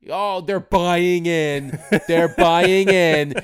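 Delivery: high, slow, loud hammed-up fan; mocking fan voice. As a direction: drawl it like a smug fan.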